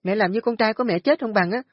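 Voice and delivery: very high, loud scornful yelling; loud complaint. A narrator's reading. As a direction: shout them down to sleep. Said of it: "chạy ra nói lớn".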